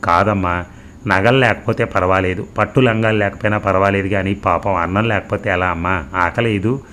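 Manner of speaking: ordinary delivery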